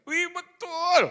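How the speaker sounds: high loud cry of recognition; excited shout. Retold or imitated. Old lady finally spotting the preacher, high loud excited cry. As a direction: cry out, it's really him.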